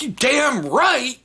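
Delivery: high, slow, drawn-out imitation of a kid; indignant agreement. Son answering dad's teasing, mock indignant agreement.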